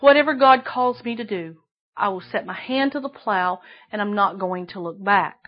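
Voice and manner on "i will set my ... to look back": usual speaking voice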